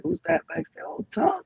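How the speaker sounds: high surprised retold exclamation; shocked. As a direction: squeak it out in startled surprise. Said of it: "I was so shocked he pulled up".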